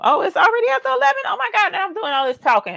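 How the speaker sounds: high, fast startled gasp of words; surprised. Real, not acted. Host startled by the clock mid-stream, fast high surprise. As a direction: realize suddenly it's late. Suddenly noticing the late hour.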